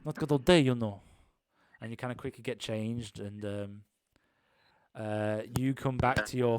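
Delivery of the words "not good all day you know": higher, nagging friend voice; a hint of an impatient tentmate; impatient guy. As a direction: hurry him up, exasperated. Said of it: "see the guy open the curtains and say".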